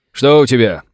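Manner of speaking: loud call across the field; shout. Acted. Sheriff calling to the second wounded man, shouting. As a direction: shout to the fallen soldier. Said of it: "крикнул шериф".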